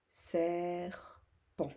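"serpent" in two separated syllables. Shown as a demonstration. Teacher repeating for pupils, syllable by syllable. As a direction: hold "ser", pause, then "pent". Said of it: "la première syllabe ou dans la deuxième".

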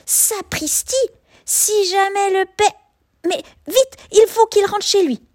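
sudden worried rush; panicked cub. A narrator's reading.

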